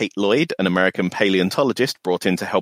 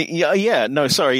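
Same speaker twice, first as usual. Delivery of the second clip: ordinary talk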